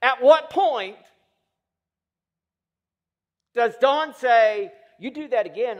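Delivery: loud, high, impassioned sermon delivery; full-force preaching. Acted. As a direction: preach it hard, driving each demand home.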